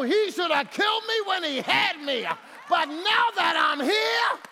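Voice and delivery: high, loud preacher's build to a shout; full-force preaching. Acted. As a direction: preach it, climbing to a roar. Shouting to congregation.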